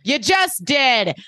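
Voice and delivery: hammed-up exasperated comeback; indignant retort. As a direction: throw it back slowly and emphatically.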